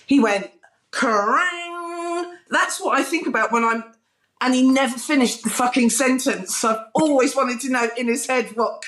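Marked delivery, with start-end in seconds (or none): 0.9-3.8 [name]. Retold or imitated. Rock singer musing on a magazine's name, mock high wail. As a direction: imitate a rock singer wailing the first word. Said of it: "[name] from [name]".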